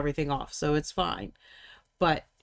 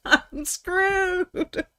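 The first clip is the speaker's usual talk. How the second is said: laughing; 13 semitones higher, 5 dB louder than usual. Real, not acted. Laughing at own despair.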